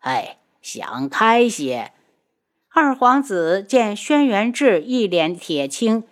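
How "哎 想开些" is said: low, slow sigh of sympathy; sigh, then console slowly in a low voice. A narrator's reading. Uncle consoling his humiliated brother, sighing. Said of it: "六皇叔叹气".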